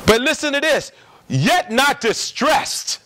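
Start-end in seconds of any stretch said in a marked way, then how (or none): 1.3-3.0 slow emphatic reading. Acted. Reading scripture verse.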